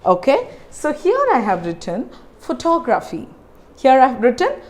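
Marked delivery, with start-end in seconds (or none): 2.4-3.3 "photography" as pho-TOG-raphy; careful model "photography", second syllable stressed. Shown as a demonstration. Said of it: "I'm stressing on the second syllable".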